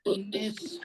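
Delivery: breathy teacher demo of निस्फ; whispered demo. Shown as a demonstration. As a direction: whisper the word निस्फ with a clear final f. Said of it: "देखा आपने?". (फुसफुसाकर निस्फ)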